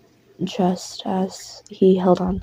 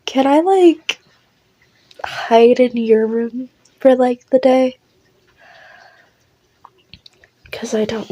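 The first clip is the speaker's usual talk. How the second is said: high, louder worried plea; anxious pleading; 4 semitones higher, 4 dB louder than usual. Acted. Larry asks listener to hide him from Ash, pleading. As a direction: beg for a favour, pitched up in protest.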